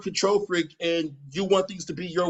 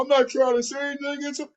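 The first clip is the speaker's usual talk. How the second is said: mocking whiny quote; 8 semitones higher, faster than usual. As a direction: mimic him as a fake-innocent excuse maker. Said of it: "Anytime you say".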